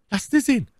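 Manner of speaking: a hint of an eager prisoner; eager demand. A narrator's reading. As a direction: ask for the knife eagerly. My Lady demands the knife.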